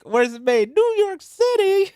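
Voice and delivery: high, loud mock cowboy-ad outcry; drawn-out, hollered ad punchline; old salsa commercial. Co-host quoting an old salsa commercial, high mock outrage. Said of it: "that old commercial from El Paso".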